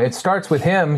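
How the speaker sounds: ordinary talk, nothing marked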